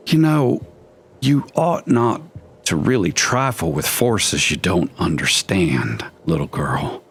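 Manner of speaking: lightly voiced menacing old man; a hint of a sinister old man.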